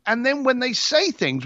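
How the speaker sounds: ordinary talk, nothing marked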